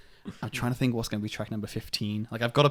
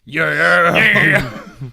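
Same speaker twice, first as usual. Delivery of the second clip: loud, high rock-singer yeah; big metal-star shout, drawn out; 7 semitones higher, 15 dB louder, much slower than usual. Acted. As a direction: belt it out like a metal frontman. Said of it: "How else do you think [name] goes?".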